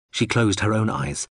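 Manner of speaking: plain reading voice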